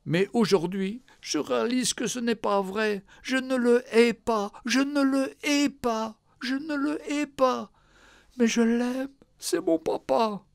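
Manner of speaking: high, breaking, pleading confession; tearful daughter. Acted. Daughter confessing to the nurse she loves her father, tearful. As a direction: say it as if fighting back tears. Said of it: "La fille éclata en sanglots".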